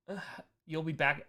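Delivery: usual speaking voice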